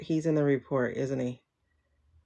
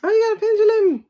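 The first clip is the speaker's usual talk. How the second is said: excited high squeal; 12 dB louder, faster than usual. Real, not acted. Reacting to viewer's pendulum.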